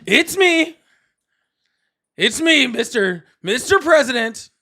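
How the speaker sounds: playful impression, punched and stretched; Donald Trump. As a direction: announce yourself with hammed-up pomp. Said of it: "Is my Trump impression getting".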